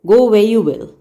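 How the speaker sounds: ordinary delivery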